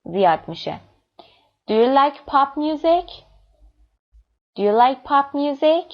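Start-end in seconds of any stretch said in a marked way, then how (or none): 1.7-3.1 yes-no question lifted at "music"; rising intonation. Shown as a demonstration. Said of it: "Yes, no questions usually have rising intonation". 4.6-5.9 steadily rising question, highest at "music"; rising intonation. Shown as a demonstration. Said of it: "Yes, no questions usually have rising intonation".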